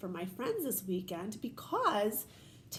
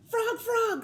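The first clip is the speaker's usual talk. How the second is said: much higher and louder calling Frog, Frog; crying out; 11 semitones higher, 6 dB louder than usual. Acted. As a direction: call out urgently to a friend. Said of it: "cried Toad".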